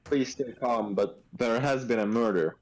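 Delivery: ordinary talk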